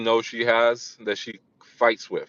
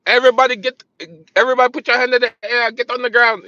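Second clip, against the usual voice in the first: high, loud, urgent stick-up command; bank robber's shout; 11 semitones higher, 6 dB louder, faster than usual. Acted. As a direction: order the whole bank down, loud and fast.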